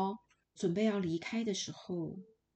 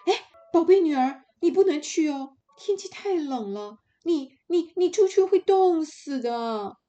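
much higher, much louder, fast alarmed cry; frightened mother; 9 semitones higher, 10 dB louder than usual. Acted. Terrified mother forbids her daughter to go out. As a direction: panic as she stops her child going. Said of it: "妈妈一听吓坏了".

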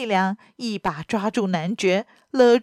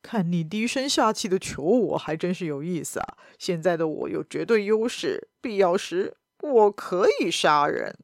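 mocking baron; 4 semitones lower, 3 dB quieter than usual. A narrator's reading. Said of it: "阿尔唐汉姆男爵笑道".

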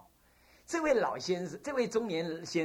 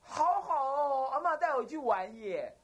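put-on excited little child; 9 semitones higher, 3 dB louder, slower than usual. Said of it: "跟他妈讲".

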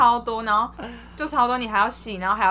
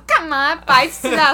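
mock scolding; 4 semitones higher, 6 dB louder, slower than usual. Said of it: "然后就狂骂说".

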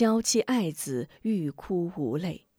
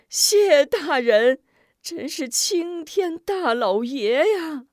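grateful cry; 7 semitones higher, 5 dB louder, slower than usual. Acted. Grateful villagers.

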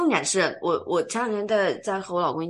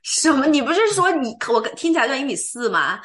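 mock indignation; 6 semitones higher, 7 dB louder than usual. Real, not acted. Teased about sounding 1.4 m tall.

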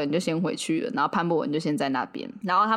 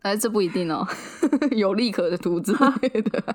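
ordinary talk

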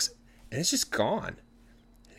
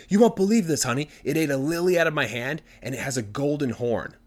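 higher, louder burst, easing off; excited husband; 5 semitones higher, 4 dB louder than usual. Acted. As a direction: gush with amazing news. Ecstatic husband tells his wife.

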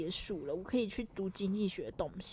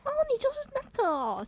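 teacher recognizing. Retold or imitated. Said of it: "他说：".

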